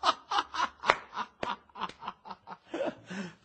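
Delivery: long laugh close to tears; a scholar laughing almost to tears at the lady's greeting; overjoyed laugh. Acted. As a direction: laugh with joy until it almost cracks.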